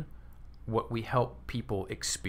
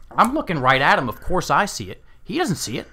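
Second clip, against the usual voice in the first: excited disbelief; 6 semitones higher, 9 dB louder than usual. Retold or imitated. Amazed at the client's blindness.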